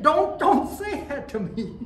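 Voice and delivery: the marshal begging him to stop, laughing; laughing mock protest. Marshal refusing again, laughing.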